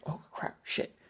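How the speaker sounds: soft unvoiced whispered curse; scared whisper. Viewer jumping at a scare in the theater, whispered curse. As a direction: whisper the swear under your breath. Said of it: "I was like".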